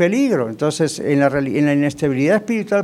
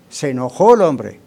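ordinary talk, nothing marked

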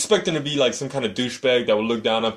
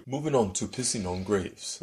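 quiet, even navigation-system delivery; 8 dB quieter than usual. Real, not acted. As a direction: announce it like a satnav. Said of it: "Sounded like a navigation system".

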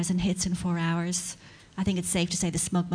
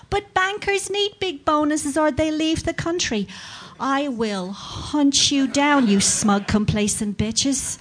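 raised, mounting comic outrage; hammed-up furious rant; 5 semitones higher, 3 dB louder, slower than usual. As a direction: rant with drawn-out, threatening emphasis. Hammed-up rant at smug mummies.

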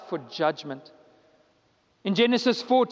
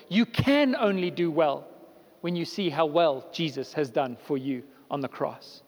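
ordinary talk, nothing marked

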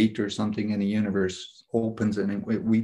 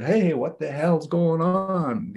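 higher, slow mock yell; retold yell; 6 semitones higher, slower than usual. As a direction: call out to a cousin left behind. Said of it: "he yells real loud".

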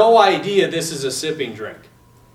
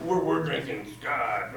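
slow, soft, lower mock-posh voice; mock affluent snob; 8 semitones lower, 12 dB quieter, slower than usual. Mocking affluent seminarians bragging about scotch, lightly posh. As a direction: lightly put on a snooty rich-man tone. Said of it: "affluent sort of".

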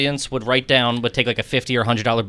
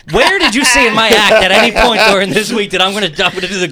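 louder and higher, an incredulous rant; exasperated outburst; 7 semitones higher, 7 dB louder than usual. Retold or imitated. Comic to magician, incredulous about the grapefruit trick, loud. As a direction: blurt it out, fed up with the secrecy.

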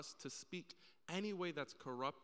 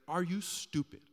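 louder, higher scolding, each word hit hard; harsh angry father; 4 semitones higher, 10 dB louder, slower than usual. Retold or imitated. Father repeating his rebuke to his kid, harsh anger. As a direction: imitate a parent tearing into a child. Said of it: "his tone is just crazy".

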